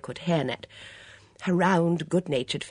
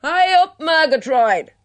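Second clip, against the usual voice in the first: stentorian, high and very loud call; powerful bellow; 13 semitones higher, 13 dB louder than usual. A narrator's reading. Calling her friend in from the orchard, powerful bellow. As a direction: bellow it right across an orchard. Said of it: "raised her stentorian voice in a powerful bellow".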